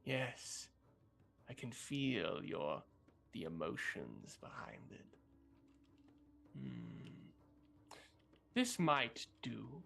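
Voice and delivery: put-on slow, hushed old witch, tasting the air; a lightly played sly crone musing. GM voicing the witch.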